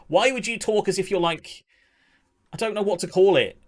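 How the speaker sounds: normal talking voice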